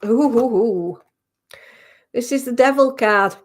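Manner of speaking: normal talking voice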